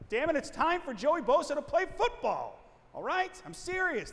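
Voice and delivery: high, loud burst of frustration; worked up and emphatic, voice jumping up; exasperated outburst. Acted. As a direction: shout it like a fed-up fan demanding action. Mock-exasperated outburst in monologue.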